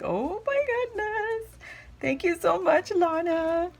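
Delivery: squealing gratitude at the sweet note; delighted squeal. Real, not acted. Vlogger thanking Lana for the gift, high excited squeal.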